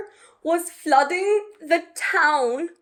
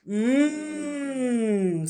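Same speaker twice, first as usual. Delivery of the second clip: mmm. Acted. Dragon tasting the gloop, relishing hum. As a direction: savour it. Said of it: "said the dragon".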